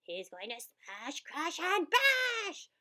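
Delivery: high loud excited cry. A narrator's reading. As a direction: cry it out gleefully. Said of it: "cried Gloom and Doom".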